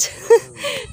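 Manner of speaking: bright burst of laughter; quick amused laugh; short laugh. Real, not acted. Laughs after showing taro plant.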